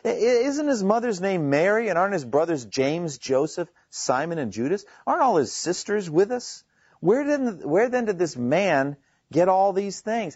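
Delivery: high, mildly incredulous string of questions; lightly acted townsfolk disbelief; amazed questioning. Amazed hometown crowd listing Jesus' family, incredulous. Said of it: "and they were amazed".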